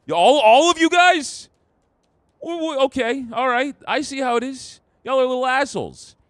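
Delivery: loud, high indignant rant at chat; play-scold the chat, all worked up; mock outrage. Scolding chat for not helping.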